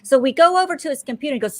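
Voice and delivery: ordinary talk, nothing marked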